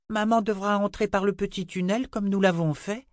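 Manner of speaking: put-on high-pitched child's questioning voice; high girlish voice, earnest question; little girl Rachel, curious. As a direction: ask it as an eager little girl, pitched well up.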